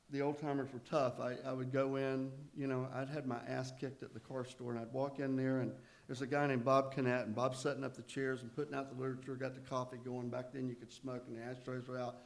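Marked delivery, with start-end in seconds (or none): none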